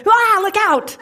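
sudden high, loud mock yelp of alarm; panicked backseat driver. Backseat driver panicking at the road, high mock yelp. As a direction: jump in like a startled passenger. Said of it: "the backseat driver who goes".

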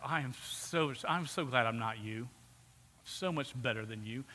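low, soft, superior aside; condescending. Acted. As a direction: look down on them under your breath. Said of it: "They're condescending to people".

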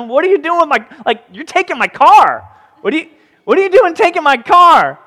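high, loud protest at strangers untying his colt; indignant owner. Acted. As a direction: bristle like someone whose donkey is being taken. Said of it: "obviously its owner said".

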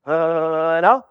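low, mumbled dumb reply; a dim man shrugging it off; clueless man. Acted. Clueless man answers the gatekeeper.

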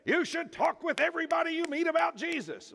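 mock preacher. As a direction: parody the pushy preacher. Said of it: "I'm a preacher, so I'm gonna say".

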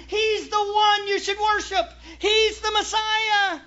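full-force preaching. Acted. Preacher driving the point home to the church, full force. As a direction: preach it out to the congregation.

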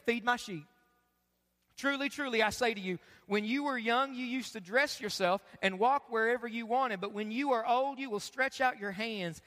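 measured Bible reading to the congregation; scripture reading. Acted. Pastor reading John to church, measured scripture reading. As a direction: read the passage steadily.

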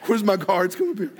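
play-yell for help through a laugh; mock call for guards. Pastor jokingly summoning his guards, laughing loud call.

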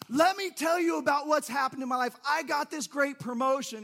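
thrilled coworker bragging; excited friend. Retold or imitated. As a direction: burst out with happy news. Excited friend shares news.

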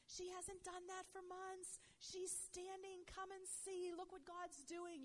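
high, slow, stretched-out cries of amazement; mock screaming. Family calling others to see her standing, imitated scream. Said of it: "screaming at the top of their lungs".

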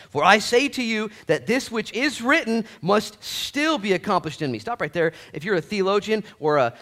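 loud, high, driving sermon voice; full-force preaching. Acted. Pastor rousing the congregation, loud fervent preaching. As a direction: preach it hard, building to the rafters. Reads scripture, preaches loudly.

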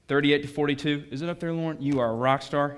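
usual speaking voice

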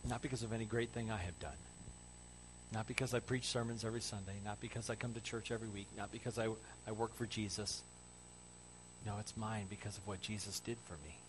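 low, soft, earnest delivery; hushed personal testimony; quiet preaching appeal. Real, not acted. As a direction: appeal gently and sincerely to the congregation.

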